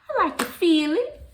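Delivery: high, loud, put-on voice on I like to feel it; playful silly voice on I like to feel it; odd put-on voice. Shown as a demonstration. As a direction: say it in a strange high breathy-sounding put-on voice. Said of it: "Why am I saying it like that".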